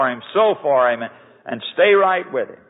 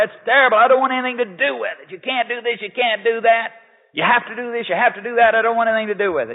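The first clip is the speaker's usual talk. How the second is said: complaining churchgoer; 8 semitones higher, faster than usual. Retold or imitated. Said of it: "I meet 'em and they say".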